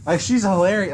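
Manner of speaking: normal talking voice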